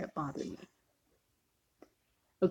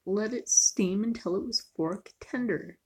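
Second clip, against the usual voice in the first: normal talking voice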